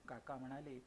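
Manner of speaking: usual speaking voice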